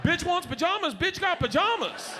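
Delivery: high-pitched cocky crowing; smug gloating. Retold or imitated. Husband gloating over his wrapped presents, smug high crowing. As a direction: crow it like a triumphant big shot.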